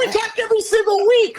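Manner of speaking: very high squeaky protest; hammed-up squeal, as if stating the obvious; mock indignant comeback. Husband answers wife about the late call, mock indignant squeal.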